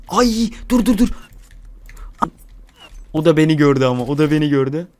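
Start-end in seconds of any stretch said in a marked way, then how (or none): 0.1-1.1 higher, louder startled dur; excited stop. Real, not acted. Gamer spots an enemy and blurts out, startled.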